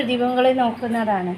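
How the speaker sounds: ordinary talk, nothing marked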